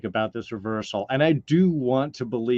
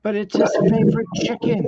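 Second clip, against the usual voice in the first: high quoted character voice, louder; high put-on voice; 9 semitones higher, 5 dB louder than usual. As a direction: pitch it up and play the character. Quoting the show in a voice.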